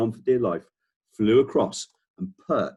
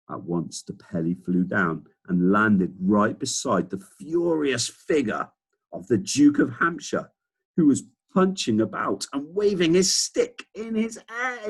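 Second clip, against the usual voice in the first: plain reading voice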